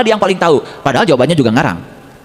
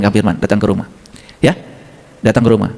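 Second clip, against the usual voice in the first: ordinary talk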